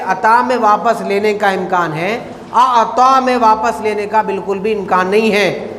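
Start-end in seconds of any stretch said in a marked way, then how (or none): none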